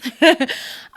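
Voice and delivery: short high giggle at herself; embarrassed laugh. Real, not acted. Vlogger laughing at her own tears, light giggle. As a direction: laugh off the nerves. Nervous laugh about getting emotional.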